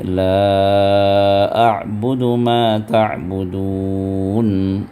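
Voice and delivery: slow chanted tajweed recitation. Shown as a demonstration. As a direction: recite slowly and evenly. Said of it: "تو پڑھیے".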